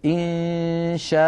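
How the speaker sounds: إن شاء slowly, final a drawn out; slow "insha'a" with a long end; pausal madd. Shown as a demonstration. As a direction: hold the last vowel of إن شاء. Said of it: "نقف عليها هكذا".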